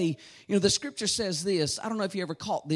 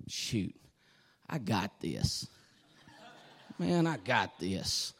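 cocky mock bravado; 13 semitones lower, 7 dB quieter, slower than usual. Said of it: "you say".

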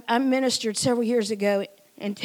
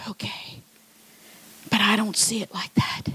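hushed private doubt; whispered inner thought. Retold or imitated. Doubting herself after her husband's compliment, whispering. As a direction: whisper it to yourself. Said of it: "And I'm thinking".

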